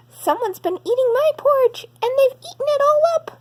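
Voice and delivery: put-on teeny tiny voice. Baby Bear finds his empty bowl, very high upset baby cry. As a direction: squeak out the upset little cub, speeding up at the end. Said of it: "he cried in his teeny tiny voice".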